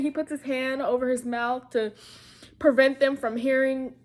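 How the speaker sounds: ordinary talk, nothing marked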